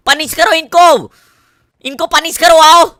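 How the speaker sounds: loud, high call to attack; shouting orders. Real, not acted. Gamer yelling at teammates to punish the enemies, loud and high. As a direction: yell at the squad to punish them.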